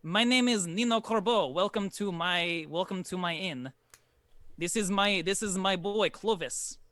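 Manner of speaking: put-on a courteous host introducing himself and his boy; lightly voiced formal innkeeper; innkeeper Nino.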